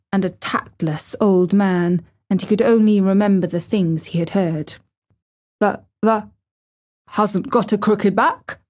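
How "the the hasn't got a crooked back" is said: put-on lightly hoarse old man; halting, a touch husky. A narrator's reading. Old gardener falteringly questions the boy, hoarse. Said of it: "he said hoarsely".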